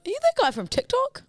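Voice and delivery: high-pitched mock fan voice; imitated stranger recognising someone. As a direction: put on a high, curious fan voice. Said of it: "they gone".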